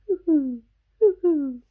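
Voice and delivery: high, loud hooting owl call. Acted. Reading to a child, the owl answers with a high, loud hoot. Snowy owl sound in story.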